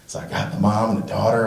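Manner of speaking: ordinary talk, nothing marked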